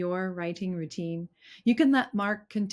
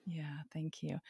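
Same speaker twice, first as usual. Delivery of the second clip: a soft, breathy whisper of thanks; 3 semitones lower, 11 dB quieter than usual. Real, not acted. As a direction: whisper it gently. Host's soft thanks at the end.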